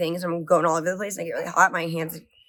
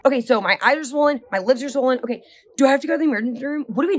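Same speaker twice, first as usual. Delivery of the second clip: higher, fast and breathless, rushing question after question; panicked questions; 6 semitones higher, faster than usual. Retold or imitated. Swollen wife panicking at her husband, high rushed alarm. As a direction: sound like you are losing it over swelling.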